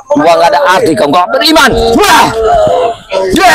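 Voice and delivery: angry shout. Acted. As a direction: shout him down with scorn. Insult then battle cry.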